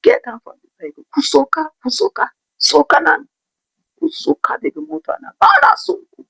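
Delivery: a driver shouting them off his vehicle; furious driver. Retold or imitated. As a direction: order them out like an enraged driver. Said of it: "He was so furious".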